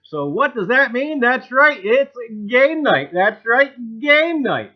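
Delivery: loud, high showman's welcome; hyped host opener. Acted. Host opening his live stream to the gang, loud showman hype. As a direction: pump up the stream crowd like a game show host.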